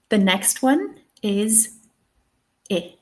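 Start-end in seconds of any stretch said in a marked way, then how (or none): 2.7-2.9 I said short, as in "his"; short vowel I. Shown as a demonstration. Teacher models the sound in "his", short I. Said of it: "it's nice and short".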